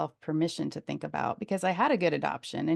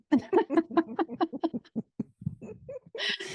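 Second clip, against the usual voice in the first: bright laugh; 11 semitones higher than usual. Real, not acted. Laughing at own joke.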